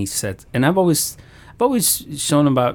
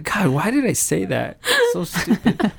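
higher, laughing through self-reproach; laughing at herself; 7 semitones higher than usual. Real, not acted. Mom laughs recalling what she told her son, higher. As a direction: chuckle at your own silly words. Laughing at own retold words.

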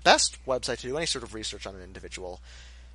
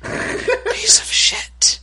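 much higher whispered insult, slow; mock sweet whisper turning nasty; mocking ASMR whisper; 7 dB louder, much slower than usual. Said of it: "So that was sort of my impression".

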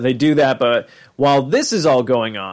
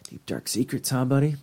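sly murmur; 8 dB quieter than usual. Retold or imitated. Teasing Goldenfold about his dream.

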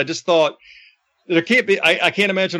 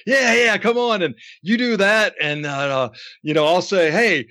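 higher-pitched, animated retold go-ahead; eager egging-on; 6 semitones higher than usual. Announcer planning a staged shove with manager, eagerly playful. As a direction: urge him on, lifted and lively. Voices Lance eagerly agreeing to the stunt.

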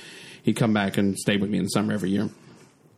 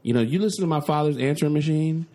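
normal talking voice

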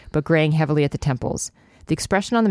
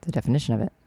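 usual speaking voice

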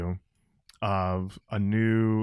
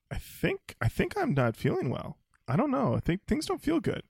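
faint woozy voice; 12 semitones higher than usual. Retold or imitated. Retelling feeling faint before passing out.

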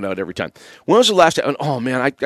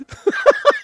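laugh. Real, not acted. Host laughs at listener's tweet.

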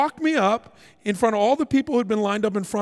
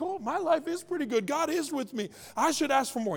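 soft, higher musing to oneself; pondering; 6 semitones higher, 7 dB quieter than usual. Acted. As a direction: think it aloud softly, pitched up. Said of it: "even pondering".

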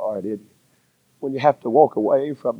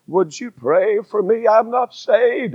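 higher, wavering plea from a lost man; quivering voice; 10 semitones higher, 3 dB louder, slower than usual. Retold or imitated. Trucker calling the preacher over the radio, voice quivering. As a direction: let the voice tremble as he calls out. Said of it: "it was a quivering voice".

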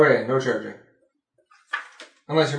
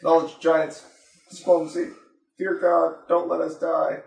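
mock desperate plea; 6 semitones higher, 4 dB louder than usual. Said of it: "made some plea of".

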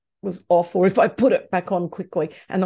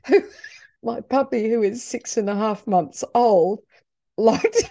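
ordinary talk, nothing marked

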